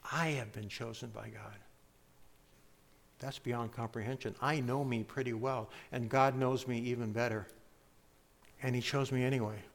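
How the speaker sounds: soft, low, personal reflection; hushed amazement. Real, not acted. Preacher reflecting on being chosen by God, hushed amazement. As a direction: drop low and soft, marvelling at it.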